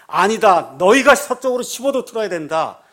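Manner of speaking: a loud answer through a loudhailer; megaphone reply. Retold or imitated. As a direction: refuse firmly over the speaker. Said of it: "확성기에서 답이 나오니까".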